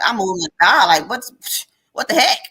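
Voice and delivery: loud, rising mock indignation; exasperated protest. Retelling her thoughts as a woman of God, exasperated outburst. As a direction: protest loudly, pitch leaping on the last words.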